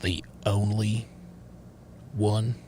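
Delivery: low, hushed father delivering grave news; disappointed father. Acted. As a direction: speak softly and low, as a father rejecting his daughter. Acts a father disowning daughter.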